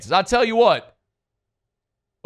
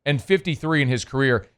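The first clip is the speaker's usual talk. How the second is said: slow, deliberate repeat of the numbers; said again slowly. Shown as a demonstration. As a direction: spell it out slowly for the back row. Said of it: "say that again slowly".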